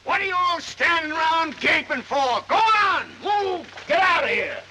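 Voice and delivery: high, loud bellowed orders; furious shout. Acted. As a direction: drive the onlookers away with a yell. Old man chases off onlookers.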